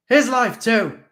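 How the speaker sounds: loud high shout. A narrator's reading. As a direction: call out urgently. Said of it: "I shouted".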